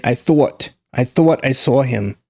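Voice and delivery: New York "thought" and "saw" with a W glide; New York rounded gliding AW in "thought" and "saw"; New York accent. Shown as a demonstration. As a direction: roll out of a W in "thought", Northeast style. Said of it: "They love to put that W in there".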